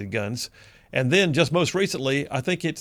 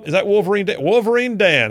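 higher and louder, glad to hear him; excited greeting; 6 semitones higher, 6 dB louder than usual. Real, not acted. Host greets caller on air.

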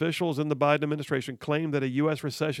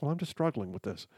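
much softer, timid excuse; mock meek excuse; 7 dB quieter than usual. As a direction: shrug it off meekly, mocking. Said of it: "they're like".

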